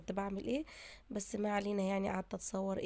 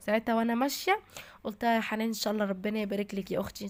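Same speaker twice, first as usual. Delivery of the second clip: normal talking voice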